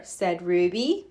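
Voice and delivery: ordinary delivery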